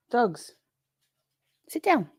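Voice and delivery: high sharp call; command to dogs. Real, not acted. Owner telling her dogs to sit, high call. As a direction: tell the dogs off.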